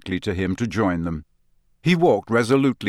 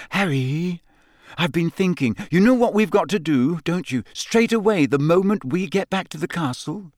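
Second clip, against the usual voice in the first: Hermione voice; 8 semitones higher than usual. Acted. Hermione raised voice.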